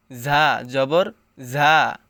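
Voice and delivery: ঝা said firm and hard, slow. Shown as a demonstration. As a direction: hit ঝা with a hard edge. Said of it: "শক্ত করে পড়তে হবে।".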